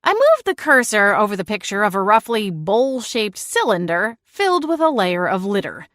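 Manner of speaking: ordinary delivery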